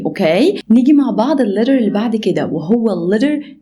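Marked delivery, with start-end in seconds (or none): none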